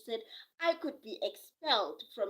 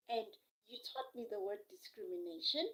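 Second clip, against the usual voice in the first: ordinary delivery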